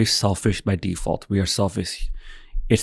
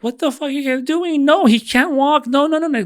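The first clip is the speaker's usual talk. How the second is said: high mock wife voice, alarmed; wife protesting to the staff, high and shrill-pitched; imitating his wife. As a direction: squeal it like a panicked wife. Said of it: "my wife walking, it's like".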